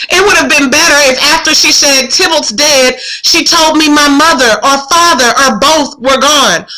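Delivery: lightly heightened reading voice; play reading. Acted. Student reading the play aloud, lightly dramatic reading. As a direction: read Juliet's lines with some feeling. Continues reading Juliet's speech.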